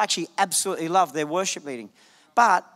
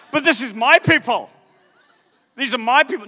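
a hint of a stubborn Pharaoh, louder; Pharaoh clinging on; 3 semitones higher, 6 dB louder than usual. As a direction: play a possessive ruler. Pharaoh refusing, voiced.

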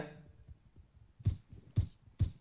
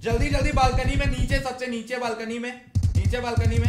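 loud, raised-pitch urgent shout; urgent callout; 5 semitones higher, 8 dB louder than usual. Real, not acted. Player warning teammates of the balcony below, urgent shout. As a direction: shout the enemy's position to your squad.

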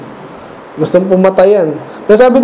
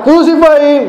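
mock crowd yell, pitched higher; retold mob shout; 7 semitones higher than usual. Preacher voicing the crowd that killed Jesus, mock shout. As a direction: cry it out like the jeering mob. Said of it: "talagang sumisigaw pa sila".

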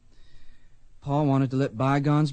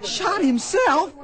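outraged cry; 13 semitones higher, slower than usual. Acted. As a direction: cry out against the accusation, much higher. Boy outraged at accusation.

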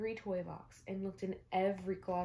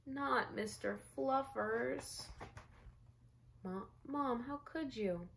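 put-on higher, slow boyish wail of dismay; a small boy horrified at losing his bunny; dismayed little boy; 6 semitones higher, slower than usual. Spencer protesting to mom, higher boyish dismay.

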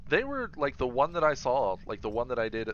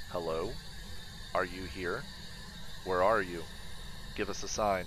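talking to a ghost. Real, not acted. Calling out to the ghost.

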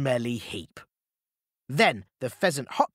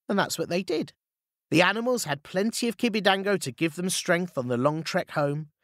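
ordinary delivery

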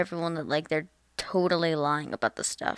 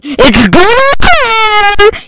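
full-throttle, high-pitched drawn-out scream; 14 semitones higher, 20 dB louder, much slower than usual. Acted. Storming in with a machete over a stolen glue stick, screaming. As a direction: scream it as a crazed war cry.

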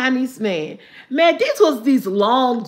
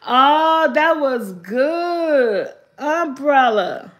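slow savoring; much slower than usual. Real, not acted. Savoring the answer.

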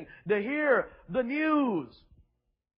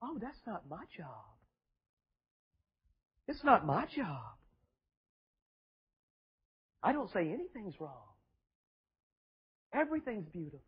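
mock soft preacher; 4 semitones lower, 7 dB quieter than usual. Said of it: "You won't even say an atheist is wrong?".

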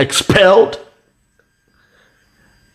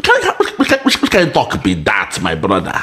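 laughing; 5 semitones lower than usual. Real, not acted. Mocking rival's claim, laughing.

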